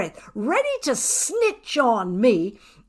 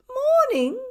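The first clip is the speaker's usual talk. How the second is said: neighbour greeting. A narrator's reading.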